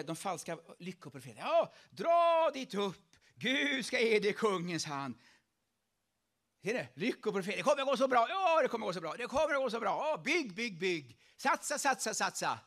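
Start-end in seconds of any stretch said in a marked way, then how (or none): 1.5-5.1 slow, loud, drawn-out prophecy; loud mock prophets. False prophets cheering King Ahab on, loud and slow. 7.6-12.6 loud, pushy hype; hammed-up yes-men egging him on; mock cheering. Preacher mocking flattering prophets, loud cheering.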